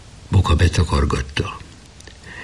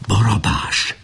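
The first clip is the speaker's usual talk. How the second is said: a stage whisper from afar; whispered name; 5 semitones higher, slower than usual. A narrator's reading. Someone outside says a friend's name, whispering. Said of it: "amint suttogva mondta valaki".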